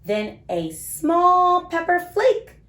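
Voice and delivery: put-on lively, sing-song storybook read for little kids; reading to children. A narrator's reading. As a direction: read it like bedtime story time for small children. Reads picture book to kids.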